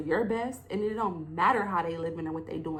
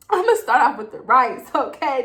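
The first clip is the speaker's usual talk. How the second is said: rice phrase burst out loud and high; emotional outburst; 4 semitones higher, 10 dB louder than usual. Real, not acted. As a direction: voice breaking with feeling, pushing it out loud. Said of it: "This gets me so emotional".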